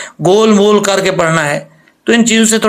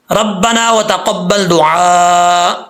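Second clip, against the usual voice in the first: slow chanted tajweed recitation; Quranic recitation; much slower than usual. Shown as a demonstration. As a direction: recite it slowly, as in prayer. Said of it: "teacher recites verse".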